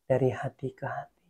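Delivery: low, hushed heart-to-heart tone; soft intimate. Real, not acted. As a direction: speak low and softly, as if close. Intimate talk with God, softly.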